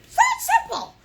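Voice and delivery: very high, loud sing-song; bubbly high cheer; excited squeal. Real, not acted. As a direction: squeal it with delight. Teacher squeals encouragement.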